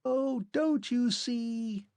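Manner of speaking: a fading machine man, faint voice. A narrator's reading. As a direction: insist from far away. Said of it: "the faint voice asseverated".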